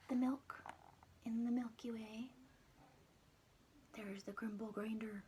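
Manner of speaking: hushed whisper to the listener; whispered aside. Acted. Storyteller whispering to the child about the picture. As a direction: lean in and whisper, secretive.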